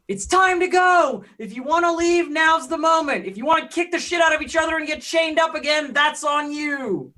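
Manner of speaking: high, loud rallying shout. Acted. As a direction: shout a warning to a crowd, stretching the last words. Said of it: "and just shout".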